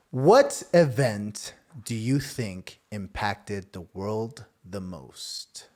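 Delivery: very slow, low game-show suspense; dramatic question reading. Acted. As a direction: draw out each phrase, dropping low and soft. Host reads the card question dramatically.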